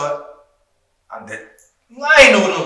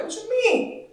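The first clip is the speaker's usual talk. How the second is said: surprised; 6 dB quieter, slower than usual. Retold or imitated. Retelling confused reply to teammate.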